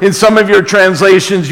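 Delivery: normal talking voice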